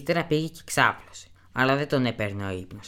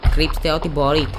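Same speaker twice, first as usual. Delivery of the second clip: loud, higher panicked call; a monk yells to his brothers to hide everything; urgent shout; 3 semitones higher, 6 dB louder than usual. Acted. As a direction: shout the warning to the other monks. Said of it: "φώναζε ένας".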